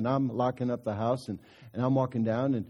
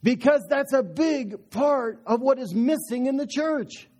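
fervent preaching; 6 semitones higher, 4 dB louder than usual. Acted. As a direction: drive it home from the pulpit. Preaching at full force.

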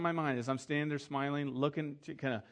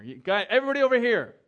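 loud, higher, fast call to gather; 8 semitones higher, 11 dB louder than usual. Retold or imitated. Pastor takes over the rehearsal and calls everyone in, loud. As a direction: grab the room's attention, fast.

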